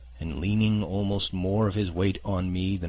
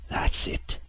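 hissed, contemptuous whisper; sneering whisper. Acted. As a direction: sneer it under your breath. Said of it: "he sneered".

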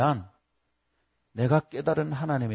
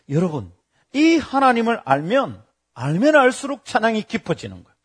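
ordinary delivery

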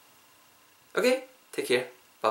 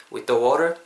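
"with the water" with light "with" and strong "water"; stress on WATER; much slower than usual. Shown as a demonstration. Teacher showing natural stress, weak "with", strong "water". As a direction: hit "water", let "with the" slip by unstressed. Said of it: "the water의 강세를 좀 더 빡세게".

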